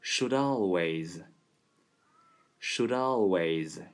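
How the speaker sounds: should always linked and drawn out slowly; linking demo. Shown as a demonstration. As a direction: join should-always into one smooth sound. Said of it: "出现一个连读现象".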